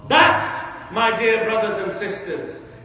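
normal talking voice

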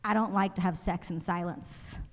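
soft seductive guy. Retold or imitated. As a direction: murmur it like a romantic. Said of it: "And he says".

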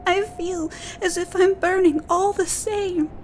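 put-on high, tearful little girl voice; a frightened girl near tears, pitched high; weeping girl. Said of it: "Natsu wept".

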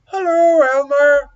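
high, loud turtle greeting; storybook turtle hailing a friend loudly. A narrator's reading. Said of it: "Hello, Turtle".

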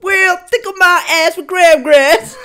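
hammed-up hillbilly twang, cracking up; southern accent. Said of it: "I love the southern accent from Amer".

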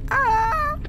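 sharp high yelp of fright; startled yelp. Real, not acted. A sudden noise in the game, yelping in fright.

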